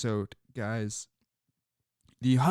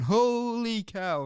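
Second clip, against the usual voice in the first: Harry Caray catchphrase; 7 semitones higher, slower than usual. Retold or imitated. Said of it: "Harry's whole thing was going".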